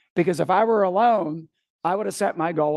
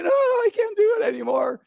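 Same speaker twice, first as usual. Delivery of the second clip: mock whiny quitter. Leader imagines quitting in front of the group, mock whimper. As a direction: play the wimp bailing out. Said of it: "Imagine me jumping out, saying".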